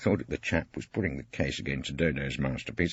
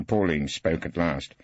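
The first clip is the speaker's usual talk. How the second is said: plain reading voice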